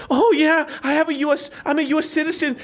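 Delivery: high-pitched mock pleading; high, hammed-up claim of citizenship; mocking quote. Mocks people suddenly claiming citizenship.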